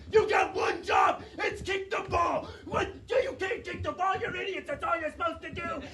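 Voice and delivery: high, outraged yell at a soccer team; exasperated high-pitched shouting. Acted. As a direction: holler like a furious sports fan. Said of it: "he's yelling at the top of his lungs".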